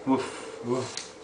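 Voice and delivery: soft, low imitated bark; dog woof. Shown as a demonstration. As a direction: woof quietly for a child. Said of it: "what sound does a dog make".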